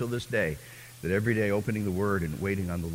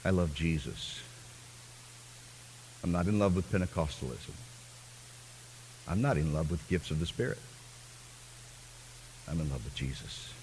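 hushed confession; 6 semitones lower, 10 dB quieter than usual. Real, not acted. Preacher's hushed personal confession.

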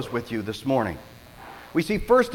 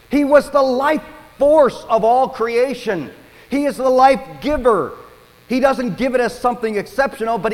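high, loud preaching that swells on life; impassioned preaching; 8 semitones higher, 6 dB louder than usual. Acted. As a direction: preach it out full force, lifting the room. Preaching at full force.

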